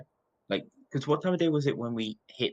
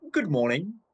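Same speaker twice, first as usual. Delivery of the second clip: breezy cheerful greeting; jaunty; 7 semitones higher, 3 dB louder than usual. Acted. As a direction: toss off a jaunty hello. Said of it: "puts on a jaunty air and goes".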